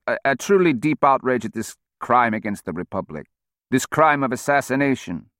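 lightly voiced, halting old politician. A narrator's reading. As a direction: read fed lines like a dazed old man. Old speaker reading fed lines.

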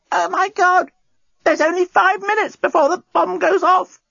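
very high-pitched alarmed cry; panicked alarm. A narrator's reading. Nettie suddenly sitting up about the bomb, panicking.

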